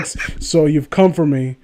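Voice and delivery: ordinary talk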